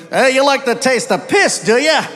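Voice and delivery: gruff Aussie bartender; Australian accent. Retold or imitated. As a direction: tease him in an Aussie accent. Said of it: "Bartender looked at me".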